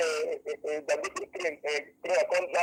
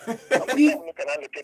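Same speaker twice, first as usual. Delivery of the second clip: louder, higher repeat; to someone hard of hearing; 9 semitones higher, 7 dB louder than usual. Real, not acted. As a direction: say it again louder for the host. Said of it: "Please speak up".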